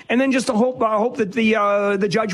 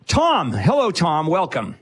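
ordinary talk

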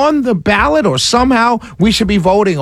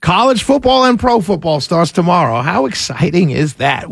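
ordinary talk, nothing marked

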